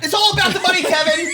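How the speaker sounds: high, loud crazed rant; mock rant. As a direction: rant it like a paranoid crank. Impersonating Quaid to co-host.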